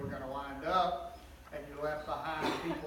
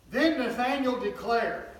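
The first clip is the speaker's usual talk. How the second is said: emphatic narration; 5 semitones higher, 7 dB louder than usual. A narrator's reading. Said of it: "exclamation mark".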